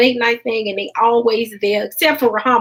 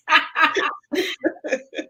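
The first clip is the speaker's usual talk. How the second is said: long, high cackling laugh; amused laugh; 5 semitones higher, 3 dB quieter than usual. Real, not acted. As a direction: laugh it out helplessly. Laughing at accent teasing.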